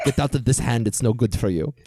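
put-on hammy Russian card-player accent; over-the-top Russian accent. Co-host imitating a poker-film villain at cards, hammy Russian accent. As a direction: ham up a bad Russian accent. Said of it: "does this very over-the-top Russian accent".

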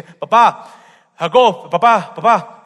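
loud call into the tent. Acted. Jacob calls his blind father, loud call.

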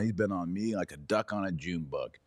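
normal talking voice

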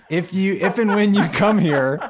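high, loud laughing question; laughing loudly. Real, not acted. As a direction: laugh through the line. Host jokes, laughing loudly.

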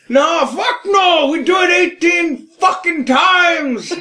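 high, drawn-out comic yell; hammed-up shout. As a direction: holler it slowly, word by word. Hammed-up outburst during comedy banter.

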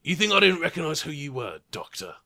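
a touch of a menacing gunman; lightly voiced sneering thug; masked gunman. Gunman confronting the Doctor, lightly sneering. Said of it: "he looks at you and he says".

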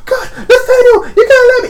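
high, loud wailing plea; desperate father. Acted. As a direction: wail and plead through a shut door. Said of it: "the guy going like".

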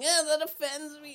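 very high mock whine; mock offended whiner. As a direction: squeak it like a touchy person. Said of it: "irrationally being like".

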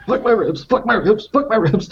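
high pained muttering with each step; mock pain. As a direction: play hurting ribs as you hobble. Beggar hobbling along with broken ribs.

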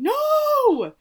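high, loud cry of "No!"; a sudden shocked scream; retold scream. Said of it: "And I literally screamed".

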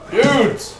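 high surfer-dude bear spirit. Acted. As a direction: call it out like a chill spirit. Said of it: "bear spirit stood in the middle of the room going".

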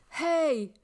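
short, high yell of protest. Acted. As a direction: call out sharply after a friend. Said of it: "Wilf yelled".

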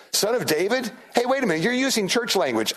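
high, incredulous objection; mock indignation. Disciples objecting to a Gentile lady's title, mock indignant. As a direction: protest like an affronted insider.

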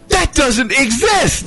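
high, slow amazed cry; disbelieving outburst. Retold or imitated. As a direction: blurt it out in disbelief. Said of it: "somebody said".

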